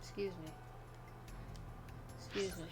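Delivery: ordinary talk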